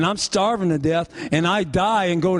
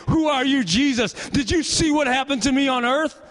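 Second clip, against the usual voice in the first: higher-pitched, incredulous questioning of Jesus; the wronged soul demanding answers, voice pitched up; indignant victim; 7 semitones higher than usual. Retold or imitated. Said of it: "they sit there in heaven and say".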